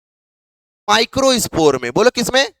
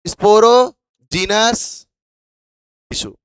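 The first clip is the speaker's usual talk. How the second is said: term drawn out in separate chunks; slow prompting; much slower than usual. Real, not acted. Teacher feeding students the term piece by piece, slow prompting. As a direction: stretch "sporogenous" slowly, pausing before "tissue".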